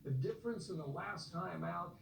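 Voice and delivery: normal talking voice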